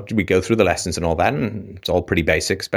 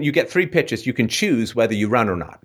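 ordinary talk

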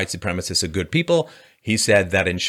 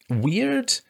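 high mock squeak; 13 semitones higher than usual. Mocking mind-reading pundits.

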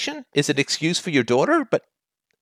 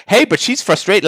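higher, mock-breezy excuse; mocking his excuse; 6 semitones higher, 4 dB louder than usual. Host parroting the caller's excuse back to him, mocking. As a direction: toss it off like a lame excuse. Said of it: "you just keep saying".

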